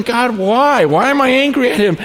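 mock bewilderment, put to God; baffled questioning.

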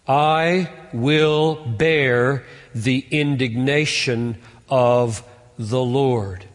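very slow, deliberate reading; solemn slow recitation; slow scripture reading. Real, not acted. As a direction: weigh every word.

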